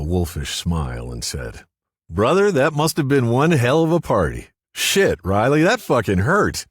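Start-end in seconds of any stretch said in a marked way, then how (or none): 2.1-6.7 loud, high-pitched outburst as Larry; loud exclaiming. A narrator's reading. Larry teases the narrator, then complains to Riley it hurt, loud exclaiming.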